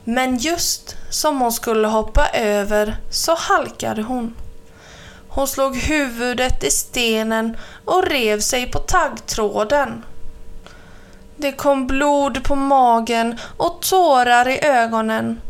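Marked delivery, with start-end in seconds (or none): none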